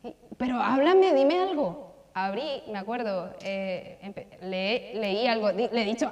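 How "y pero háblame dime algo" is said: higher and louder, pleading; retold tearful plea, raised voice; desperate crying prayer. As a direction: cry out to God that you can't go on. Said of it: "me puse a llorar y le dije".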